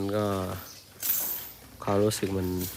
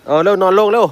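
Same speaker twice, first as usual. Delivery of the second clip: high, loud orders to lie down; loud urgent call to an elephant; urgent command; 9 semitones higher, 6 dB louder than usual. Real, not acted. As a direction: hurry the elephant down with sharp calls.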